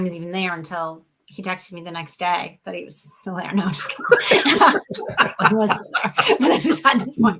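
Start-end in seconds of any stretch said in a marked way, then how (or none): 4.1-5.4 light burst of laughter at her own joke; laughing it off after a tease. Real, not acted. Laughing at her own joke to a colleague.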